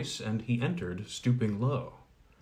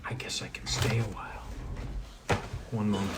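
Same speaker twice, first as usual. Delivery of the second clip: whisper; 2 semitones lower, 5 dB quieter, faster than usual. Real, not acted. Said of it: "aside to someone off-mic".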